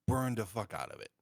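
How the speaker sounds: ordinary talk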